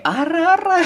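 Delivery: high cute squeaky ara-ara; sweet playful anime girl; cutesy anime voice. Shown as a demonstration. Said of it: "yang kayak".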